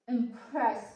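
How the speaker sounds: "Impress" with PRESS hit harder; second-syllable stress. Shown as a demonstration. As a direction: say "Impress" with a light IM and a strong PRESS. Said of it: "stress more and, uh, in the second syllables".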